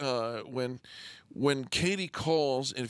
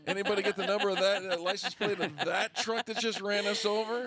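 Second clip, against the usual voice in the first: high, fast mock-stunned joke; 10 semitones higher than usual. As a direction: reel like you were run over. Joking about a harsh truth.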